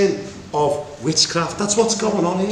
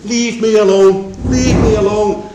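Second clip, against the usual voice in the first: louder, slow, insistent leave me alone; pleading God voice; 2 semitones higher, 5 dB louder than usual. Acted. God telling Moses to stand aside, loud and insistent. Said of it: "God's saying".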